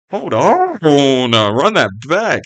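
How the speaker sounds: loud, high, swooping exclamation; hyped reaction. Real, not acted. Host reacting to guest's quotable line, hyped. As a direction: stop everything, loudly thrilled by that line.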